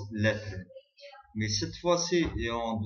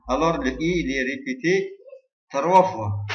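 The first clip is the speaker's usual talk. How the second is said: emphatic announcement; 4 semitones higher, 9 dB louder than usual. Real, not acted. Teacher stresses key result.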